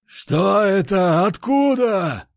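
high, slow bellow of alarm; roaring. Acted. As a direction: roar it out in shock. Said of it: "ревел генерал Кабанов".

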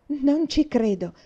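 put-on girl cries out at news of a stepmother, loud and slow; flushed outburst. A narrator's reading. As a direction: blurt it out, red in the face. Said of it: "esclamò una rossa in viso".

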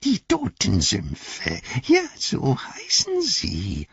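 Gollum langsam und höher, genüsslich; schmunzelnd. A narrator's reading. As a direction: koste es aus wie eine listige Kreatur. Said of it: "schmunzelte er". (Gollum belehrt Sam schmunzelnd und gedehnt)

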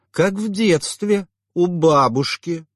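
slow high nostalgic; dreamy. A narrator's reading. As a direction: drift off into childhood. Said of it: "задумчивым тоном".

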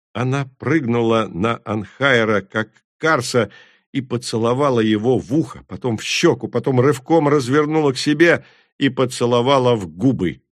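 plain reading voice

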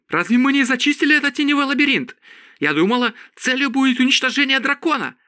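rushed, high-pitched protest; high-pitched cry. A narrator's reading. Priscilla questions whether the labyrinth was cleared, fast high cry. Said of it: "закричала Присцилла".

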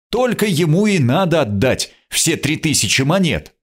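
ringing, assured declaration; Arnold. A narrator's reading. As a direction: call out firmly across a hushed square. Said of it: "ясный, сильный голос Арнольда".

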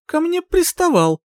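high, strained blurt. A narrator's reading. Heroine fighting her oath, blurting out. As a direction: let the wrong words burst out high. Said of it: "а с языка рвалось другое".

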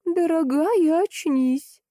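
put-on high-pitched old-lady voice; Жозя. A narrator's reading. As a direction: call the sleeper in a high, coaxing voice. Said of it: "повторила Жози".

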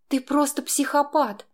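high, stunned outburst. A narrator's reading. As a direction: gasp it out in shock. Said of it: "выдохнула я ошарашенно".

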